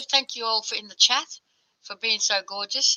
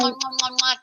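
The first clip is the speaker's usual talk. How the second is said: big wet smooch; 3 semitones higher than usual. Real, not acted. Blowing kisses while signing off.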